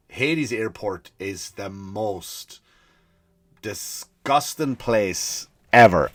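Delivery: very slow, weighted delivery; slow, emphatic verdict. Real, not acted. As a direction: drag out each word for emphasis. Dramatic verdict on the airport.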